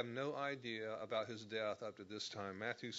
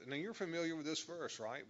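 usual speaking voice

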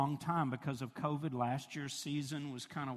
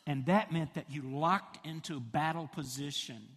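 normal talking voice

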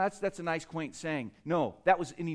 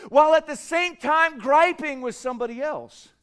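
rising preacher's shout; 6 semitones higher, 6 dB louder than usual. Acted. Preaching climax on worship and conflict.

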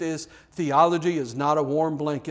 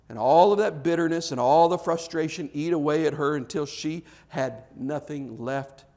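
ordinary talk

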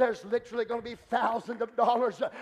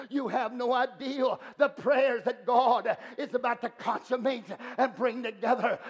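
ordinary delivery

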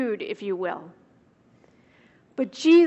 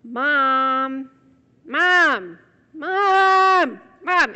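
mock child whining for mom, rising each time; child calling mom; 5 dB louder, much slower than usual. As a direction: call mom like an impatient little kid. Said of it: "there's those cries".

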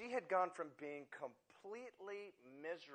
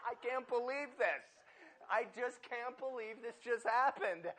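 high, laughing disbelief, much higher and louder; imitating her laugh-crying as she gasps the words; retelling the stranded woman's stunned, giggly disbelief; shocked hysterical laughter; 12 semitones higher, 8 dB louder than usual. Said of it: "in between shock and hysterical laughter".

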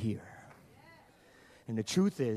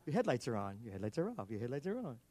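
computer voice; 4 semitones lower, 9 dB quieter, faster than usual. Retold or imitated. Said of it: "the computer voice go".

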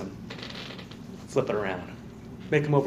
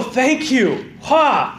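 flippant ungrateful sinner; 7 semitones higher than usual. Retold or imitated. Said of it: "And you go".

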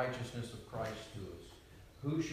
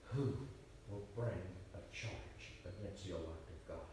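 quiet defiant retort; 6 semitones lower, 10 dB quieter than usual. Retold or imitated. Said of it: "you say".

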